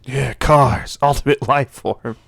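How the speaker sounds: loud, edgy anime rival voice; brooding cool hero growl; Shadow the Hedgehog voice. Acted. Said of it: "I'm stuck in my Shadow the Hedgehog voice".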